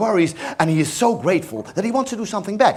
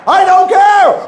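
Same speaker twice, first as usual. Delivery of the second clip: defiant shout; 9 semitones higher, 7 dB louder, slower than usual. Acted. Dwight defiant reply.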